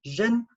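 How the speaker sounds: "zhin" pitched high with buzzing zh; sound zhin. Shown as a demonstration. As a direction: lift and buzz "zhin". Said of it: "That makes a zhin sound".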